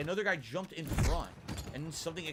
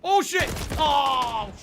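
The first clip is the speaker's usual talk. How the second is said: high, loud, sudden curse; startled yelp; 10 semitones higher, 6 dB louder, much slower than usual. Real, not acted. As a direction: caught off guard mid-game. Sudden danger in game.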